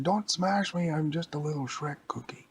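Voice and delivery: high, small pleading voice; pleading Shrek cookie. Acted. Little cookie begs Hulk not to smash, pleading. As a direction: beg like a tiny cartoon cookie. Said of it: "And the Shrek cookie said".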